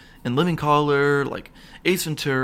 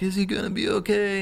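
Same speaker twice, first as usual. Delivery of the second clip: imitating a worried, weeping wife; mock tearful; 7 semitones higher than usual. Wife at the hospital bedside, mock tearful. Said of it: "his wife's in there crying".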